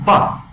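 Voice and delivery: "باس" with a bursting b, short vowel; "باس" as a short, sharp English "bus"; explosive B. Shown as a demonstration. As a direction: punch out "باس" loudly.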